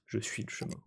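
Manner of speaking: soft unvoiced whisper. Cultist whispering his creed to Gary. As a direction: breathe it almost silently. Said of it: "le techno chamanatrix rugit".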